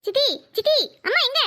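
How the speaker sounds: high, urgent calling out for help; frantic high-pitched child's cry; panicked child. Acted. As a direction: call for your aunt in alarm. Child runs in with urgent news.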